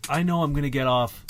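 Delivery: ordinary talk, nothing marked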